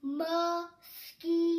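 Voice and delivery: mosquito stretched out slowly and clearly; slow model word. Shown as a demonstration. Teacher modelling a new word for kids, slow. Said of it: "học con này".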